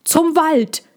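urgent call to flee. Acted. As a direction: shout the direction to your friend. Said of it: "rief Pontus ihm zu".

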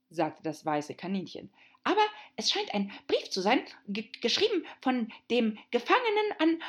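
high, hurried and stammering; a flustered little rabbit, tripping over words. Acted.

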